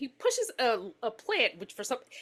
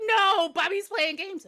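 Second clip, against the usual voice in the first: high, drawn-out panicky insistence; worked-up mother pleading; frantic insistence; 8 semitones higher, 4 dB louder, slower than usual. Acted. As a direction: insist desperately, pitched up.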